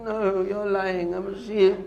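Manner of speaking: high, slow sheep-like voice; bleating denial. Acted. Lion cub insisting to the lion he's a sheep, bleating. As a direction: protest in a drawn-out bleat.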